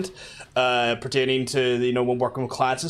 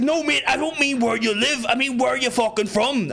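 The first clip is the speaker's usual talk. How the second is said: exasperated, much higher Belfast cabbie; Belfast taxi driver accent; 10 semitones higher than usual. Acted. Taxi man demanding where passenger is really from, exasperated Belfast accent. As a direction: ham up an insistent Belfast driver. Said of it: "that sounds like the taxi man accent".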